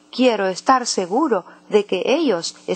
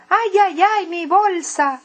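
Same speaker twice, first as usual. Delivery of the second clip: distressed wail; 8 semitones higher than usual. Acted. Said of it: "todavía gritando".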